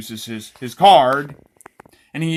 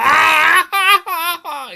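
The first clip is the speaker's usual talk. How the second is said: high, loud, long wailing scream; mock scalded scream; 8 dB louder than usual. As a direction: scream like a cartoon victim hit by boiling oil.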